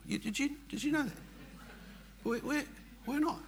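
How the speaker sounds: high, mock-surprised question; wry high-pitched aside; sarcastic jab. Real, not acted. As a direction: ironic exaggerated disbelief.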